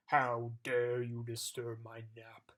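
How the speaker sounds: furious giant bellow. Acted. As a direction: bellow down at the tiny intruders. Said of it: "he bellowed furiously".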